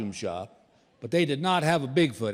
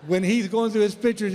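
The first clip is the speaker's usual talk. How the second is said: normal talking voice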